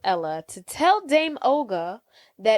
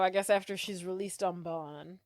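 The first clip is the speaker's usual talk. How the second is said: ordinary talk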